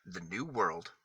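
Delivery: gentle storybook reading, letter by letter; reading to children. Acted. Matthew reading an alphabet book to kids, gentle storybook voice. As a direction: read the alphabet book aloud to little kids.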